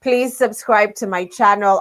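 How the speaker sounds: ordinary talk, nothing marked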